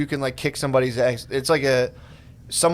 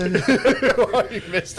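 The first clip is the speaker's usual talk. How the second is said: mock bartender; 7 semitones higher, slower than usual. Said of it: "the fifty-year-old bartender's like".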